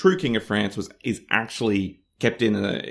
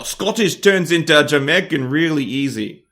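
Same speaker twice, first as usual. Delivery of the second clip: louder, higher Scottish slipping towards Jamaican; Scottish drifting into Jamaican; 4 semitones higher, 5 dB louder than usual. Shown as a demonstration. Showing co-host how forced accents slip, Scottish turning Jamaican. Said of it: "your accent turns into something else".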